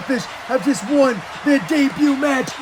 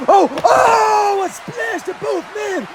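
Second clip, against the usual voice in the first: excited shout; 11 semitones higher, slower than usual. Acted. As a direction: yell out the big splash. Big move called out.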